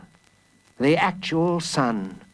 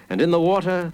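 usual speaking voice

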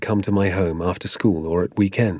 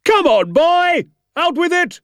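very high, loud, drawn-out yell; impatient shouted order; parade-ground shout; 5 dB louder, slower than usual. A narrator's reading. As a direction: holler it like a drill sergeant. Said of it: "shouting at me as though I was a platoon".

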